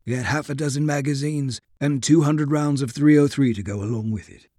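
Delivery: usual speaking voice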